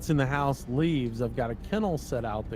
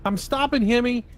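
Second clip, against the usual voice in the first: calling out; 11 semitones higher, 7 dB louder than usual. Real, not acted. Announcing stop to fellow driver.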